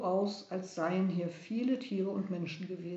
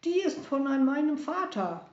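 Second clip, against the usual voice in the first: higher, louder, bursting out; excited call; 7 semitones higher, 6 dB louder than usual. Acted. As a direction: cry out in recognition of his father's coin. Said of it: "Melchior rief".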